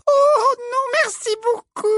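very high simpering falsetto; coy little girlish simper. A narrator's reading. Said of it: "petit rire minaudant".

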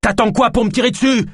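very high, furious yell; enraged, provoking shout; mad with anger. A narrator's reading. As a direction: scream a reckless dare. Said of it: "folle de colère".

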